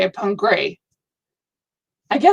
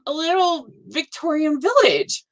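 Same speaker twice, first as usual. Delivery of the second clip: ordinary delivery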